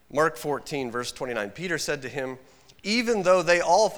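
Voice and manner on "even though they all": loud, high, cocky protest; boastful outburst. Acted. Peter boasting to Jesus, a loud cocky protest. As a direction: blurt it out like a boastful disciple.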